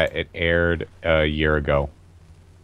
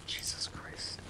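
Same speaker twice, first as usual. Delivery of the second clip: sighing whisper, slow; exasperated whisper; 21 dB quieter, slower than usual. Real, not acted. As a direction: breathe it out in disbelief, slowly. Sighs at a loaded question.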